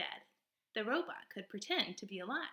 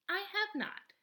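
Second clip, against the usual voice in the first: higher, even robot voice; 12 semitones higher than usual. Acted. Robot answering the possum in a higher, even voice. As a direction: answer plainly, like a machine.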